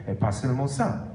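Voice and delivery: usual speaking voice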